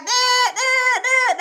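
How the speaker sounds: high, crying shout of nah; machine refusing. Retold or imitated. Detector machine refusing fake diamonds, crying high shout. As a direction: wail the nahs like a panicked machine. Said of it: "that little thing would be like".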